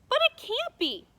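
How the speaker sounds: put-on loud, high child protesting; a girl refusing the sad ending; high indignant protest. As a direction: cry out in disbelief as the girl.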